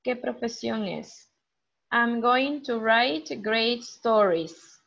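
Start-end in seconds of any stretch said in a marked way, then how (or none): 1.9-4.8 slow model reading. Shown as a demonstration. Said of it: "Which profession is it?".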